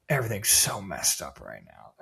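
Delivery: low, quiet gloom; hushed, hopeless impression of a partner; mock despondent. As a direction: imitate a defeated doomscroller, voice sunk low. Imitates despondent doomscrolling partner.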